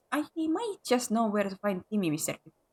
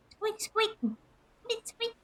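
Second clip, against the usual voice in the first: high, soft squeaking; tiny high mouse voice; mouse squeaks; 12 semitones higher, 5 dB quieter, much slower than usual. Acted. Imitating a mouse.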